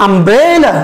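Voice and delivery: "umbrella" with a long, stressed middle syllable; stress on BRE. Shown as a demonstration. Teacher leads students in saying "umbrella", middle syllable stressed. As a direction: swell on the middle of "umbrella", then fall away. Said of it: "এখানে আমরা একটু জোর দিয়ে বলবো".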